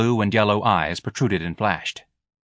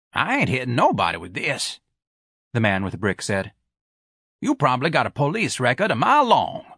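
gruff refusal; 4 semitones higher than usual. Acted. Brick man refusing.

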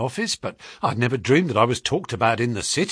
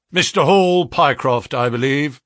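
brisk, raised voice of a businesslike caller; sharp brisk visitor; 7 dB louder than usual. A narrator's reading. Financial agent greets the clerk, brisk and sharp. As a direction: clip it like a man who values his time. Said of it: "spoke sharply".

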